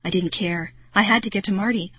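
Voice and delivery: ordinary delivery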